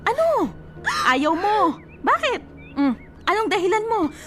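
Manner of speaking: sharp, high, incredulous retorts; exasperated. Acted. Cousin scolding her for defending the cheater, exasperated. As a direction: snap back at her excuses.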